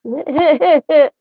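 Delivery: short run of high-pitched giggles. Real, not acted. Giggling fondly after mimicking a friend, high laugh. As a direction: giggle in a quick, high burst.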